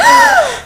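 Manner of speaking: sharp high mock gasp. Acting out the narrator's gasp, mock gasp. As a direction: gasp like a shocked heroine.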